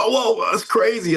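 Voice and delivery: retold high-pitched amazement; wowed, voice jumping up; amazed reaction. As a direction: react as if just hearing big news.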